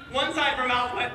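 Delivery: usual speaking voice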